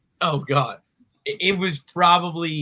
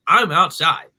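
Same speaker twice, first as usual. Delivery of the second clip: retold insistence, punched louder; insistent retort; 3 semitones higher, 7 dB louder, slower than usual. Customer arguing with delivery driver by phone, insistent. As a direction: push back loudly, as if on a phone.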